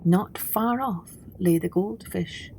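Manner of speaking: plain reading voice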